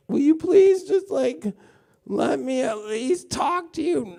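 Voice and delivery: very high, slow, whiny begging; a high, slow plea to a partner who won't talk; mock pleading. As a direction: beg to be heard, hammed up. Pleading partner in acted quarrel.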